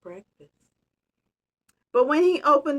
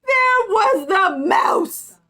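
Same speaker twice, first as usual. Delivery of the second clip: much higher, louder, very slow; dramatic reveal; 10 semitones higher, 9 dB louder, much slower than usual. Acted. Reader revealing the mouse with mock shock. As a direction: announce it with big suspense.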